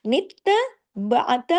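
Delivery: नित्तबअत with the noon pressed hard into the ta, slow; noon joined firmly. Shown as a demonstration. Teacher demonstrating tashdeed, नित्तबअत said slow and firm. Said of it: "नून को सख्ती से ता के साथ जोड़ना है".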